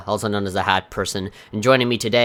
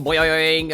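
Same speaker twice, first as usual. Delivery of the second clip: bouncy springy boingy voice; 4 semitones higher than usual. Acted. Bouncing sound effect.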